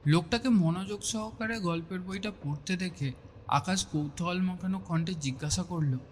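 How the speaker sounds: plain reading voice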